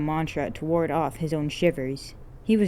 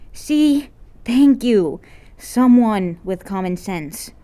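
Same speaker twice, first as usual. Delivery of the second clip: high, loud gloating; vindicated and smug; girl gloating over her win; triumphant cheer; 5 semitones higher, 8 dB louder, slower than usual. Acted.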